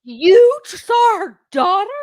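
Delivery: high, loud and slow, drawn out in disbelief; mock shocked question. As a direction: ham up her outraged surprise. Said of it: "she goes, spins that head around".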